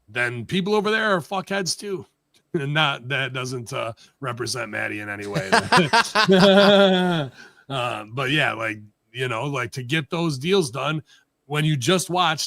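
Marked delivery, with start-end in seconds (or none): 5.5-7.3 loud, rolling burst of laughter; real laugh. Real, not acted. Host cracks up after his disclaimer, real laughing. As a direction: crack up loudly.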